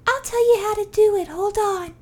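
put-on high, eager kid voice; little kid voice. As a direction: promise help like an eager child.

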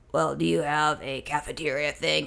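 a grumpy clerk mumbling a question; imitating the clerk. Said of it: "she says".

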